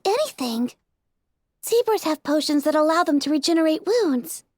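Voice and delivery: timid mare, earnest; Fluttershy voice. A narrator's reading. Fluttershy explains her plan.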